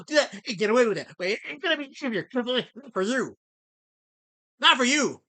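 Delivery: loud, high heated denial; heated rebuttal. Real, not acted. Streamer loudly rebutting a chatter's story about him, heated. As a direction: tear into the liar.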